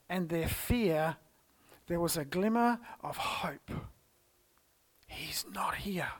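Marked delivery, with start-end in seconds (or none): 5.1-6.1 hushed whisper. Acted. Preacher whispering the angel's news to the congregation. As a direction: whisper it like a secret.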